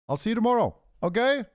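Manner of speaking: high, loud cheerful farewell; loud high goodbye. Real, not acted. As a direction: call out the goodbye brightly.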